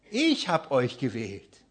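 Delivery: slow, ich given the weight; stress on ich. Shown as a demonstration. Preacher quoting Jesus, ich stressed and slow. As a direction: lean on ich in ich hab euch gewählt. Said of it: "die Betonung".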